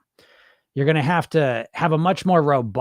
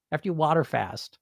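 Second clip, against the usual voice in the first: usual speaking voice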